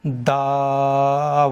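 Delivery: दाऊदा with the वाव drawn one alif long, no hamza in the throat; slow recitation, lips rounded for a clean वाव; Quran recitation demo. Shown as a demonstration. Teacher reciting the correct form slowly for students. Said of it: "इसको खींचेंगे एक अलिफ के बराबर".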